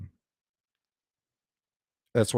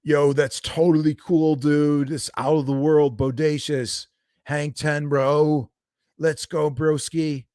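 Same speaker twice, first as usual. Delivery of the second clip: high, loud laid-back surfer dude; 6 semitones higher, 3 dB louder, slower than usual. Shown as a demonstration. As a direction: say it like a stoked surfer bro. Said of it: "sometimes I do use a surfer voice".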